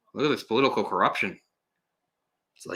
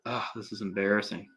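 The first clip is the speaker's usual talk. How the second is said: normal talking voice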